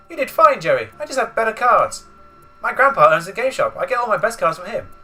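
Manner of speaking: put-on high, bright-eyed boy voice; youthful hero voice. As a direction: play it like an eager teen. Voicing young Yugi dialogue.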